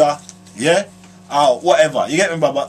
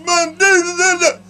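high put-on voice of a guy brushing it off; squeaky mock voice, dismissive; imitating a casual bloke, pitched way up; mimicking a man; 12 semitones higher than usual. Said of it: "And the man say".

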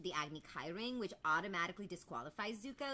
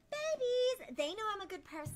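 put-on much higher, louder baby talk to the wolves; cooing pet talk; 7 dB louder than usual. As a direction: coo at the wolves like they're puppies.

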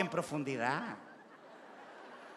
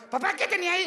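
put-on high, louder child's voice; a kid asking his dad, higher pitched; little kid voice; 10 semitones higher, 4 dB louder, faster than usual. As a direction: ask innocently like a small boy. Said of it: "el cabro chico".

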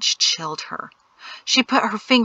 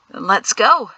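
louder, mildly grunted resolve; 2 semitones higher, 5 dB louder than usual. A narrator's reading. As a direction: push it out with a light grunt. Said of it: "she grunted".

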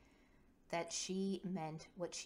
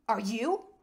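loud, high retort; sharp retort; 5 semitones higher, 12 dB louder than usual. A narrator's reading. Kova, wrestling with the seatbelts, retorts loudly at Quinn. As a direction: throw the question back, loud and rising. Kova snaps back at question.

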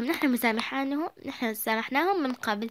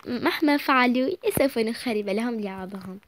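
ordinary talk, nothing marked

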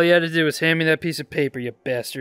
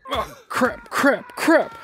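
panicked; 8 semitones higher, much slower than usual. Real, not acted. Spotted by guards.

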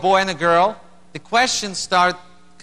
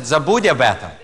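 said in Russian with a laugh; laughing, in native Russian; Russian phrase; 5 semitones lower, faster than usual. Retold or imitated. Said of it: "which means".

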